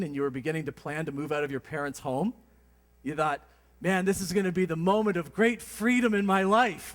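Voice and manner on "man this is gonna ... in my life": louder, higher, eager outburst; mock youthful excitement; excited teen. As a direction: proclaim it like a teen dreaming of freedom. Imagined teen thrilled to move out.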